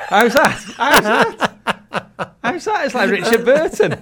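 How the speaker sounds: high, loud laughing questions. Real, not acted. Laughing as he asks his co-host how it sounded. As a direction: laugh through it, pleased with yourself. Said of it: "It's like, uh, Under Milk Wood".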